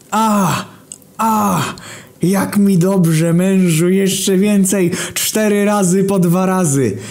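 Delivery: high, slow, moaning woman's voice; moaning through the wall. Acted. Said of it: "Słyszysz głosy zza ściany".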